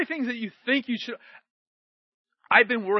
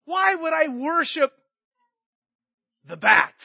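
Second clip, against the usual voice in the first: normal talking voice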